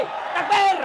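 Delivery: high, loud call for takbir; rallying shout. Real, not acted. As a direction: cry out to rouse the congregation. Calling the congregation to takbir. (seruan takbir lantang)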